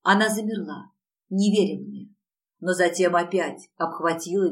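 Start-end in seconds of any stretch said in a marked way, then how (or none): none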